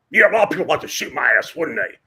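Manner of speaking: hint of a grumpy coach firing back; gruff coach. Woody Hayes snapping back at the TV reporter, lightly voiced and quick.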